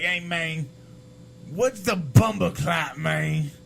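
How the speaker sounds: lightly Jamaican "Hey, man, what's the bumper clot"; a hint of a Jamaican guy; Jamaican accent. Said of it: "'cause that's how he talks".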